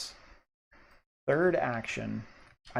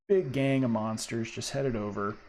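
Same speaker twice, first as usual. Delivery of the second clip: normal talking voice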